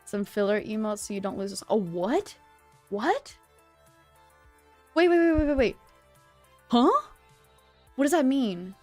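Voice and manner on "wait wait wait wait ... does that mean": usual speaking voice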